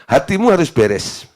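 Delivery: ordinary delivery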